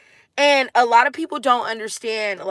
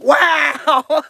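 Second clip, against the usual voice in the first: put-on kids amazed; 5 semitones higher, 5 dB louder than usual. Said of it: "all the kids was like".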